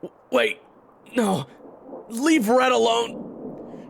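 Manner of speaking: high, very loud desperate cry; desperate shout. Acted. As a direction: scream to stop an attack on your friend. Hero screams to save friend.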